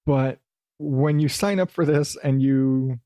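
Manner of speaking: ordinary talk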